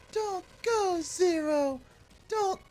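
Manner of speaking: high falsetto plea; pleading game character. Acted. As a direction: beg in a very high put-on voice. Voicing pleading cutscene character.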